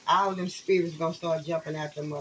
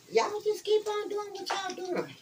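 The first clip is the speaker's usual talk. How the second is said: high taunting voice; 13 semitones higher than usual. Pretend.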